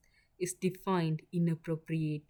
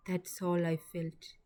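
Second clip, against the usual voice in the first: plain reading voice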